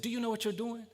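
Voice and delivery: high, insistent challenge; imitated old man's high-pitched challenge; stern old man. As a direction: demand it like a stern elder confronting someone. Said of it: "he said it one more time".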